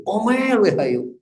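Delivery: high, stretched-out mock scold; imitating a nagging outburst. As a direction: launch into a high-pitched nag. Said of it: "pakai sepatu ini diomelin".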